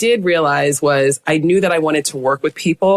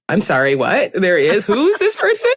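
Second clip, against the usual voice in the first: rising, amused disbelief breaking into a laugh; giddy disbelief; incredulous disbelief. Retold or imitated. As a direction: act stunned and delighted at the news. Incredulous retold reaction.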